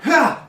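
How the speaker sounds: a sharp, loud barked grunt "Ha!"; loud effortful grunt through a door; imitated grunt. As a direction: grunt it out like someone taking a hit. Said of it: "it sounds like this grunt".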